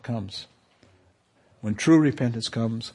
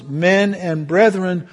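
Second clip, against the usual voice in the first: rising to a high, loud plea; pleading cry; 8 semitones higher, 10 dB louder than usual. Acted. Congregants pleading with Peter, high loud cry. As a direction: cry out desperate for an answer. Crowd's plea voiced in sermon.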